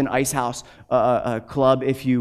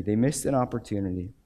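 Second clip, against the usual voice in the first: softer, lower echo of the line; quiet repeat; 4 semitones lower, 6 dB quieter than usual. Shown as a demonstration. Preacher letting the lesson land on the church, quiet repeat. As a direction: let it sink in, hushed.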